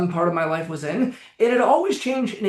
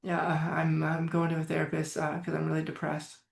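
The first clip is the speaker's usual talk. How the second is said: much softer, deflated imitation of a gloomy teen; fading, dejected voice, half-imitated; mock depressed; 3 semitones lower, 10 dB quieter than usual. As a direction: sink into a heavy, low-energy mumble. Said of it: "not a fake of".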